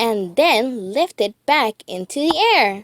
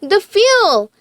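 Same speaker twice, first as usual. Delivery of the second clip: Joy voice; 9 semitones higher, 5 dB louder than usual. A narrator's reading. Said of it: "said Joy".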